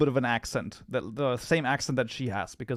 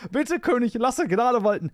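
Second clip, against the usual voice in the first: higher pleading plea; 8 semitones higher, 4 dB louder than usual. Acted. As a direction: beg the king for mercy. Pleading figure in poem.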